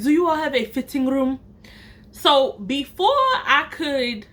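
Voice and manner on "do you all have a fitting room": customer's lightly put-on African accent; imitated African-accented question. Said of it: "like, African or something".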